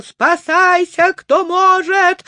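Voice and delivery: высоко, громко, медленно, в панике; кричать в ужасе; испуганный крик. A narrator's reading. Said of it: "схватился за голову и закричал". (панический крик)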